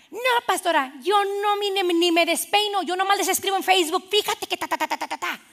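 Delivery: high-pitched protest running into rattled syllables; self-excusing church member. Acted. Church member telling pastor she only posts on Facebook, high excuses.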